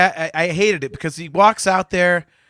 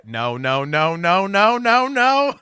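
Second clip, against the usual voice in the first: mounting "no" in a cartoon voice; Cleveland impression; much slower than usual. Fan watching the light turn red, Cleveland-style rising dread. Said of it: "like, you know, Cleveland in, in Family Guy".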